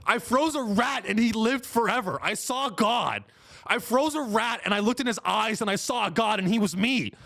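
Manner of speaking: high, insistent raving; hammed-up deranged rambler; subway crazy guy. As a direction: rave like a madman on the subway. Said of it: "This is a guy who's like".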